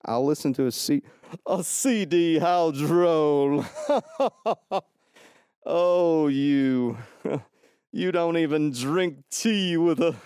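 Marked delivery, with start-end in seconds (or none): 1.4-10.2 higher, drawn-out snooty put-on voice; stupid voice. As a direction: do a silly, slow, superior character. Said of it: "for me to do that stupid voice".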